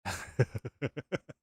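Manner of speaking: bright burst of laughter; high laugh. Real, not acted. Chat message amuses him.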